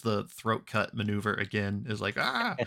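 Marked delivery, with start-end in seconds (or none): 2.2-2.5 short high mock yelp; a sudden high yelp, half joking; startled yelp. Finley's fake throat-cut gag.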